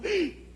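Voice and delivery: sharp high gasp of shock; a high startled cry. Acted. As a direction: gasp as if catching him red-handed. Wife catches husband with lover.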